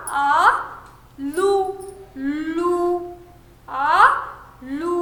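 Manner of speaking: आलू split into आ and लू, the लू held long; syllable by syllable. Shown as a demonstration. Teacher to young pupils, spelling out आलू with a long लू. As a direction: stretch the ऊ in लू long for the class. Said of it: "जैसे आप कौन सा शब्द आलू".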